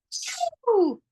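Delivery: high, explosive achoo; big sneeze. Acted. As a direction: let the sneeze burst out. Wolf sneezes.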